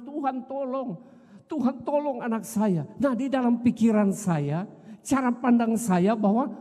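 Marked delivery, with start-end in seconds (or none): 0.0-2.8 high, soft, tearful imitated prayer; tearful plea. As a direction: replay the crying prayer, pitched up and hushed. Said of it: "sungguh-sungguh menangis".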